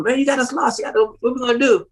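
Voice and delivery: a friend ribbing you for getting lost; teasing friend. Retold or imitated. Friend voiced teasing in story.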